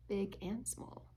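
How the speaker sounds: ordinary delivery, nothing marked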